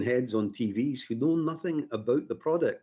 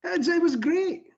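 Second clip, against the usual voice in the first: excited; 6 dB louder than usual. Real, not acted. Asked what growing up was like.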